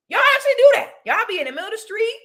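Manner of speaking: high-pitched, acted astonishment; incredulous high squeal, retold; shook. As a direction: re-enact being amazed, voice shooting up, rushed. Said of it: "I was shook".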